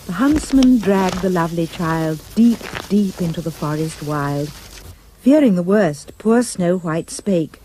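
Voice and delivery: usual speaking voice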